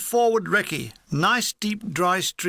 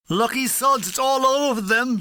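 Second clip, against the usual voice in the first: gunner's envy; 5 semitones higher, 3 dB louder than usual. Acted.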